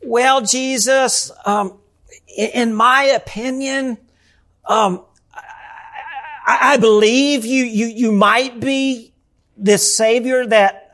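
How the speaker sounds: mock-timid, stammering and unsure; a hint of a shy believer mumbling; timid, hesitant. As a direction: play a nervous doubter hedging every word. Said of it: "is not this timid or passive".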